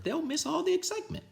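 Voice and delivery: high, sing-song principal; high cheery principal. Acted. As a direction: say it with fake cheer. Principal voiced.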